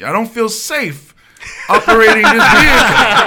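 milk the union phrasing; mock-formal complaint. Driver claiming he feels unsafe: hammed-up earnest. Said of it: "All the trigger words".

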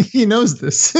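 amused remark through laughter; laughing. Real, not acted. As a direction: laugh as you say it. Laughing at friend's awkward story.

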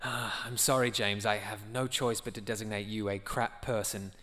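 ordinary talk, nothing marked

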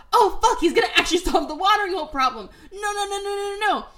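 high, loud, laughing panic; panicked Scar. Retold or imitated. Scar panicking as his plan fails, in a high laughing squeal. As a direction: squeal in mock horror as it falls apart.

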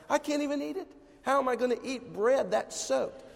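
high-pitched exasperated whine, re-enacted; retold upset. Husband protesting to his wife over soggy bread, upset. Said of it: "And I was just so upset".

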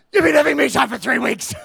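loud, high, fed-up yell; exasperated shout. Retold or imitated. Extrovert yelling at the introvert, loud and exasperated. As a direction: blast it at the lazy friend. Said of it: "And the extrovert guy".

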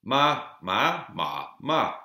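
ma ma ma ma, each with a different Mandarin tone; four tones demo. Shown as a demonstration. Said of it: "they use tones".

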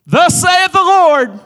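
high, loud, slow prophetic proclamation; hammed-up Thus saith the Lord; mock prophet. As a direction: boom it like an old-time prophet. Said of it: "call you out and prophesy".

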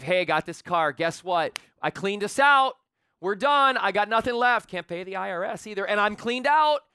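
loud, hammed-up husband announcing reckless news; boastful announcement. Husband telling his wife about the car, loud mock boasting. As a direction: belt it out like a proud fool.

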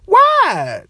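high squeak of disbelief; can't believe it, squeal it; high incredulous why. Real, not acted. Exasperated question to Derrick Rose.

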